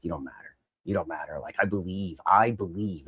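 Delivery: low, soft dismissal; quietly intense. Retold or imitated. Fan voicing a wrestler staring down a rival, quietly intense. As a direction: imitate a wrestler's hushed intensity.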